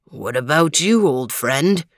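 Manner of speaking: low and kindly, asking a friend; deep dog voice. Acted. Overton asks.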